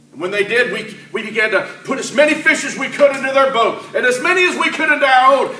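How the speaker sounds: ordinary talk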